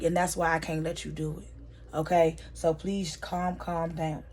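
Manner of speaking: soft, restrained pleading to settle down; quiet calming. Retold or imitated. Blue holding Madam back, quiet and calm. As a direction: gently talk them down, softly.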